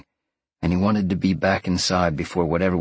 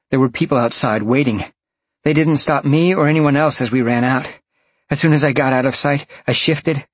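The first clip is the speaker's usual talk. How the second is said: urgent, higher-pitched report from someone running; panting hard; 7 semitones higher, 4 dB louder than usual. A narrator's reading. Roxy running from the fire, phoning Sean, lightly panting. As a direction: narrate a runner's excited phone report, lightly breathless. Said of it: "Roxy was panting hard".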